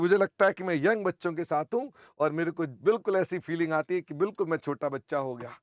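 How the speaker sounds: ordinary talk, nothing marked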